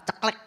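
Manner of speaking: short, crisp "ceklek" of a latch; imitated door click. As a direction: mimic a door clicking open.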